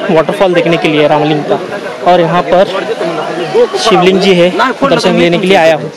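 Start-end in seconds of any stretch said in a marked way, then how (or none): none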